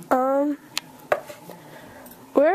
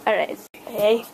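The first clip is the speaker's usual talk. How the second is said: ordinary delivery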